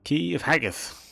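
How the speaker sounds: put-on Scottish accent, lisping the s in Haggith; Scottish accent, high and loud, lisp on Haggith. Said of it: "Scottish person with a lisp".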